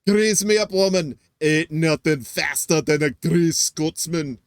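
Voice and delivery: loud cartoon Scotsman; Groundskeeper Willie. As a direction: bellow it in a thick Scottish brogue. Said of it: "groundskeeper Willie from The Simpsons".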